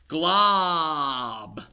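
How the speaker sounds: loud booming announcement; dramatic reveal. Acted. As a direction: unveil the monster. Monster reveal.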